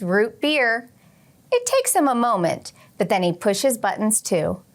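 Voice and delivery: gentle storybook read-aloud voice; reading aloud to children. Acted. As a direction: read it like story time for the class. Teacher reads picture book aloud.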